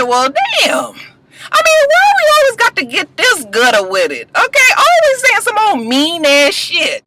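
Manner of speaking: very high-pitched, appalled protest; shocked reaction. Retold or imitated. Vlogger reacts to Lucius's cruel words, shocked and high-pitched. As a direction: squeal it in mock dismay.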